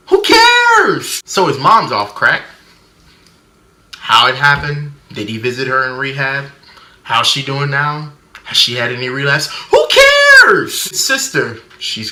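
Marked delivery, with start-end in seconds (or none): 0.1-1.2 high, loud "who cares" shout; exasperated yell. Acted. Running dismissive gag about the movie. 9.7-10.8 high, loud "who cares" shout; exasperated yell. Acted. Running dismissive gag about the movie.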